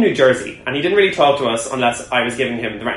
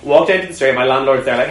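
usual speaking voice

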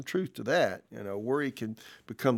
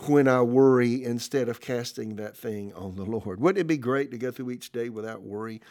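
ordinary talk, nothing marked

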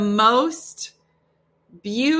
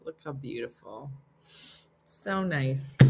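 ordinary talk

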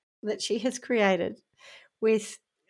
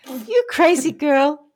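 drawn-out teasing coo, louder; mock giddy slow coo; 4 semitones higher, 6 dB louder, much slower than usual. Victim cooing back at her scammer, mock giddy and drawn-out. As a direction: play the smitten victim, slow and loud. Voicing the victim's flirty reply.

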